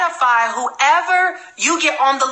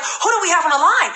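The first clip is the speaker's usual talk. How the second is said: imitated excitement, pitched higher; Alassane Seck, fired up; 5 semitones higher than usual. Recruit hyping his sponsor on the line, imitated excitement. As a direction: mimic an eager hype man. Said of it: "He is so fired up and excited".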